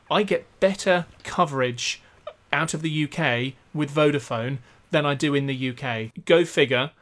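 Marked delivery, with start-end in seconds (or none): none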